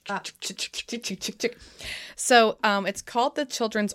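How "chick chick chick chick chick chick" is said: soft ratcheting chain-lift clicks; roller coaster clicking. Pretend. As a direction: imitate a coaster ticking uphill. Imitating roller coaster climbing uphill.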